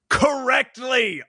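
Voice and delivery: big slow exclamation; all-caps exclamation. Retold or imitated. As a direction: read it like it's in all caps. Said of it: "All caps, exclamation point".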